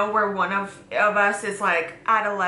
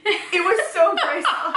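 laughing; 8 semitones higher than usual. Real, not acted. Laughing about graceful fall.

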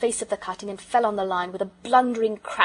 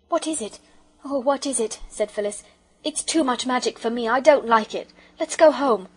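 frightened; 4 semitones higher, 5 dB louder than usual. A narrator's reading. Frightened girl wants to go.

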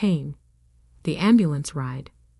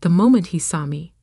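ordinary delivery, nothing marked

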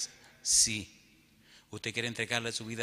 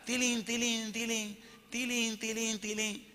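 imitated little bells; 12 semitones higher, slower than usual. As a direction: chime lightly like small handbells. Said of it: "empiezan a sonar unas campanitas".

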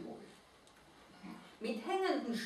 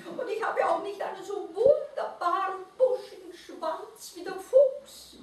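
high creature voice, whispered start, then quicker; sad little mole-rat; 10 semitones higher, 4 dB louder than usual. A narrator's reading. Mole-rat rejects the owl's comfort, high voice.

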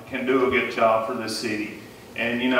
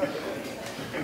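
soft, breathy laugh under the breath; quiet breathy laugh; 12 dB quieter than usual. Real, not acted. Candidate apologizing to the moderator, quiet breathy laugh. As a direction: let a quiet laugh slip out. Laughs again after his speech.

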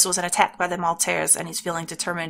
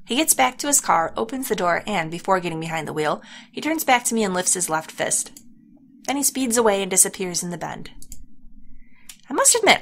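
ordinary talk, nothing marked

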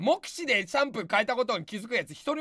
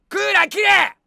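snapping a loud order across the room; angry shout; 8 semitones higher, 10 dB louder than usual. Real, not acted. Streamer yelling at staff to kill the air conditioner, angry shout. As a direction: bark the order at full volume, much higher.

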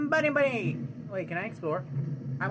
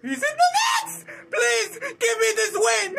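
high, frantic cry; panicked pleading; 10 semitones higher than usual. Real, not acted. Gamer begging the game for a win, panicked cry. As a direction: beg desperately, pitched way up.

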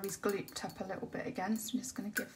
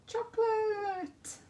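Chocolate! stretched out slowly, much higher and louder; drawn-out Chocolate; 13 semitones higher, 5 dB louder, much slower than usual. Pretend. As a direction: sing out Chocolate! long and high, just for fun. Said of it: "why you like hearing me say that".

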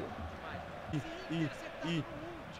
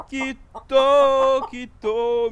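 gleeful shout; 5 semitones higher, 5 dB louder, much slower than usual. Real, not acted. Opponent quit, gleeful.

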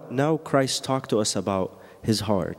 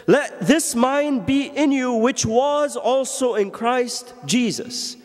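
high, slow, ringing proclamation of the verse; proclaimed verse; 12 semitones higher, slower than usual. Acted. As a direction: declare it to the whole church. Verse proclaimed, then repeated hushed.

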